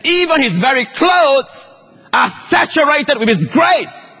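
high, drawn-out sermon delivery; impassioned preaching. Acted. Preacher telling the church of her faith in Jesus, impassioned. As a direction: preach it with rising fervor, stretching the key words.

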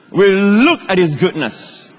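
high, drawn-out sermon delivery; impassioned preaching. Acted. Preacher telling the church of her faith in Jesus, impassioned. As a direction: preach it with rising fervor, stretching the key words.

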